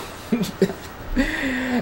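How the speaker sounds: high, squeaky laugh. Real, not acted. Reader sorry for the bad accent, high laugh.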